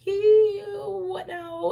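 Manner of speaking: high, squeaky put-on whine of disgust; high mock-distressed whine; mock whiny dismay. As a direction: play the lost person going ew. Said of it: "it's like".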